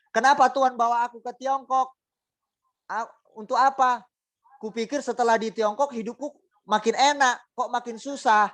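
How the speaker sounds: high whiny sulk, as a grumbling believer; sulking complaint. Acted. A congregant sulking to God about her hardship, whiny. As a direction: pout and whine at God. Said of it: "Coba mereka ngambek".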